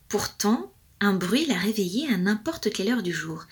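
ordinary delivery, nothing marked

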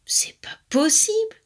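high, drawn-out indignation; vexed grumble. A narrator's reading. Romarin accusing his little brother, vexed. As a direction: accuse in sulky disbelief. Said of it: "grommelait Romarin vexé".

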